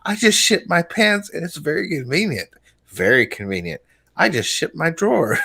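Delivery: put-on old couple voice. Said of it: "this old couple".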